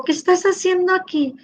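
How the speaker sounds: a mild mock of her own concerned asking; puzzled question. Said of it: "le digo".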